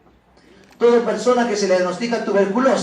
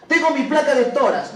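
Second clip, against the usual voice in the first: higher, louder eager patient voice; imagined patient; 5 semitones higher, 5 dB louder than usual. Pretend. Teacher voicing a patient proudly presenting a test, eager and raised. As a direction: play the patient showing off his test.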